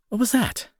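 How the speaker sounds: sudden high alarmed question; startled. Acted. To the partner who suddenly stumbles, startled alarm. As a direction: jolt up in pitch, caught off guard.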